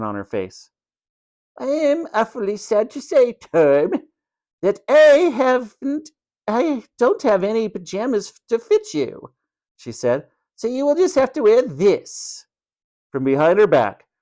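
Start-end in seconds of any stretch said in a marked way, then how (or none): 1.6-9.3 high, halting, falsely regretful matron; mock-sorry matron. A narrator's reading. Matron telling Tom she has no pajamas, falsely sorry. 10.6-12.4 high, gloating reveal; smug matron. A narrator's reading. Matron presenting nightdress to Tom, high and smug.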